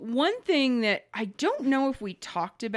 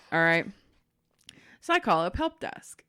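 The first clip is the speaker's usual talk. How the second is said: ordinary talk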